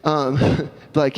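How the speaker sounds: ordinary talk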